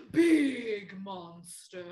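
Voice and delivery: slow, loud, growly big monster voice; hammed-up giant monster. Shown as a demonstration. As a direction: stretch it out huge and loud. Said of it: "Normal monster".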